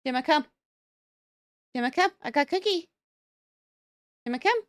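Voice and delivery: calling a dog. Real, not acted. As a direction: keep calling the dog, insistent.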